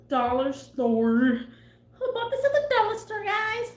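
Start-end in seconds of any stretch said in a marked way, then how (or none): 2.0-3.2 high, fast Elmo squeak. Pretend. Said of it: "Is that an Elmo voice?". 3.2-3.7 high squeaky Elmo voice. Pretend. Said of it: "Is that an Elmo voice?".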